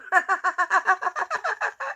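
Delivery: soft high falling laugh. Real, not acted. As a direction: let a quiet high laugh fall away. Laughs after God joke.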